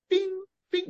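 high, light "bing, bing"; tiny springy bounce sound; cartoon hopping noise. Imitates Pepe Le Pew hopping.

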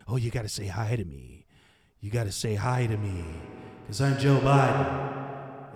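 mock Biden. Host mocks Biden for viewers, low creepy old-man voice. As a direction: make him sound like a creepy old man. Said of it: "You, you hear this guy?".

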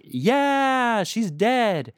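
high, loud, careless cheer; mock cheering townsfolk. Townspeople cheering the witch's death, mock careless. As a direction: toss it off like the crowd celebrating a death. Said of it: "they're like".

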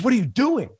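a sleepy wife's surprised what-are-you-doing; puzzled question. Retold or imitated. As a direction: caught off guard by her husband in the doorway, startled and high. Wife wakes, sees him in doorway.

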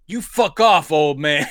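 put-on shouted brush-off at the old man; mock shout. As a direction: snap it out like a defiant teen. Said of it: "the boyfriend going like".

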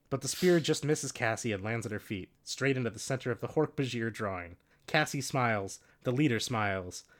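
normal talking voice

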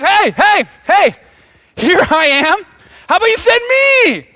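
loud, high, eager shouting; jacked-up excitement. Acted. Isaiah begs the huddle to send him, excited high shout. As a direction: shout to be noticed, bursting to volunteer. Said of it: "He's so jacked up and excited".

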